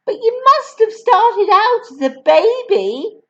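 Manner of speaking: put-on high, far-off child voice; small boy. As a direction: ask innocently like a little boy. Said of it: "the boy said".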